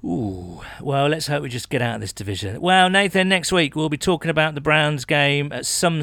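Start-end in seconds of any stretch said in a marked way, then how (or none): none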